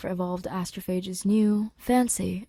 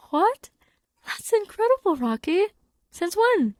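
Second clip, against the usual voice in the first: much higher and louder, astonished; shocked delight; 11 semitones higher, 5 dB louder than usual. Acted. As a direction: gasp it out in amazed joy. Grace shocked by egg news.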